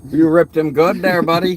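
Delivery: loud, high mock Minnesota accent. Streamer teasing a friend from Minnesota in a loud mock Minnesota accent. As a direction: ham up a Minnesota buddy voice. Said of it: "that's why I got the accent".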